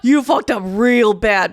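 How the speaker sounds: loud, slow re-enacted warning; retold outburst. Woman warning the driver threatening her, loud and drawn out. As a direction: lean hard and slow on every word. Confronts him after his threat.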